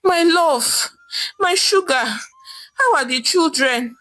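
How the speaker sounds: husband in jail pleading with his wife. Acted. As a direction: beg with a catch in the voice. Jailed husband pleads on phone.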